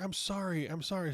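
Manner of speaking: high, frantic apology; apologetic Sharon. Retold or imitated. Customer apologizing to the shop owner, high frantic pleading.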